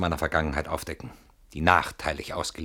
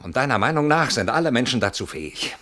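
ordinary talk

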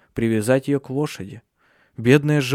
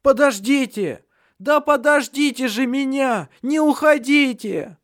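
high pleading cries, dragged slowly; desperate shout; 13 semitones higher than usual. A narrator's reading. Dying Redhead shouts after his comrades, high desperate pleading. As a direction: call after them as they vanish. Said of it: "Он закричал".

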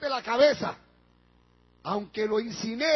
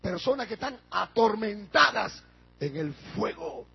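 ordinary delivery

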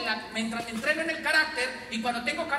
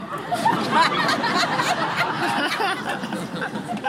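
long high burst of laughter; 11 semitones higher, 4 dB louder than usual. Real, not acted. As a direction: crack up at your own joke. Laughs at own story. (carcajada aguda)

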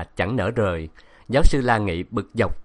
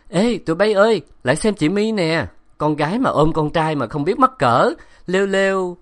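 put-on high, loud childish jeering; mock the girl like a cheeky child; teasing kids; 8 semitones higher, 5 dB louder than usual. A narrator's reading. Kids jeering at My for hugging a boy, high sing-song taunt.

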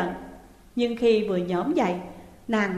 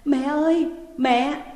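higher, louder anguished call; wailing out; 5 semitones higher, 4 dB louder, slower than usual. Acted. Daughter clinging to mother, wailing her name. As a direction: cry out for mother. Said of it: "Nàng gào to lên".

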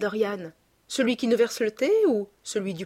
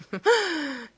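high-pitched acted chuckle; laughing; 8 semitones higher than usual. A narrator's reading. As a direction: let out an airy little laugh. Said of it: "dit en riant Lord Henry".

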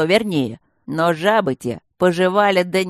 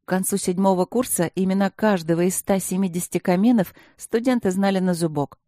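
usual speaking voice

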